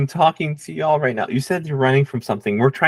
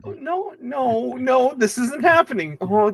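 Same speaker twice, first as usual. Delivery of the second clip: panicked; 9 semitones higher than usual. Acted. Panicked character, high pitch.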